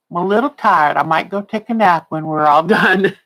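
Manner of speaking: ordinary delivery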